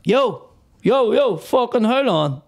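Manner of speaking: a hard man stopping the comic mid-show; imitated heckler call. Heckler at back interrupts show.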